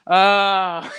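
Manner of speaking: loud mock groan of dismay; retold groan. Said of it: "you know, I just groaned".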